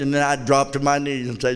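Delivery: ordinary talk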